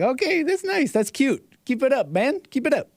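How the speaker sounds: high-pitched mock encouragement; imitating an indulgent onlooker. Imagining a reaction to a harmless rival, high patronizing mock approval. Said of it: "He's like".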